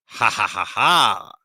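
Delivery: deliberate, spelt-out ha-ha-ha; put-on laugh, last ha drawn out; acted laugh. Friends laugh at the droid, staged laugh. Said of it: "They all laughed".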